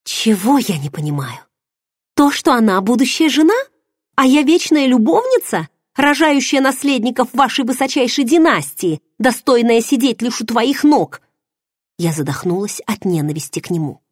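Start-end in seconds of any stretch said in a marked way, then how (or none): 0.0-11.2 furious. Acted. Heroine rages at her lover over his bride. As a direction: hurl the accusation at him. Angry outburst.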